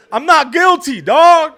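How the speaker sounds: loud, high-pitched protesting yell; defiant outburst. Retold or imitated. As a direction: blurt it out at full pitch. Blurts plea to judge, retold.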